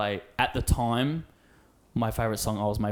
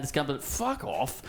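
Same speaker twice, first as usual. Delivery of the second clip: ordinary talk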